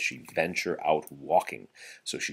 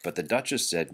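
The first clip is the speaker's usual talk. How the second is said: plain reading voice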